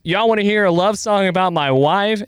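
playful stage-banter imitation. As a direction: imitate a singer calling out to the crowd. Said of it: "and then [name] goes".

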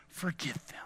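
hushed whisper, leaning close; soft breathy don't forget; whispered plea. Acted. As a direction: whisper it like a secret to your son. Whispered charge to son.

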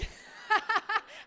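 breathy burst of laughter; airy laugh. Real, not acted. Speaker laughing at her own story, airy burst of laughter. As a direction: laugh out at the memory.

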